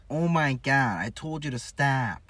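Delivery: loud, high, nasally Wisconsin impression; hammed-up Wisconsin complaint through the nose; nasal Midwestern accent. Mimicking how Wisconsin people talk, nasally Midwestern accent. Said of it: "They have, like, really nasally".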